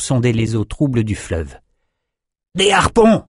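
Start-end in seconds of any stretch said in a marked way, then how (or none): 2.5-3.2 high, loud shout; excited sailor shouting. A narrator's reading. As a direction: call out across the deck. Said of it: "cria l'un d'eux".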